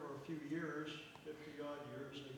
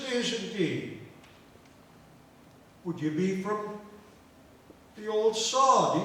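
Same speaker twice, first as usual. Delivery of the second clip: loud, higher Irish lilt; Irish brogue; 5 semitones higher, 12 dB louder, slower than usual. Acted. Two countrymen meeting at the bar, hearty Irish brogue.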